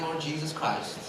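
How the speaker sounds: mock-earnest prayer gathering force; fervent prayer. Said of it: "I was just praying".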